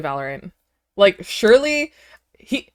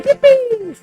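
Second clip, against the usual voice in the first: excited cheer; 6 dB louder than usual. Real, not acted. Cheering along to birthday track.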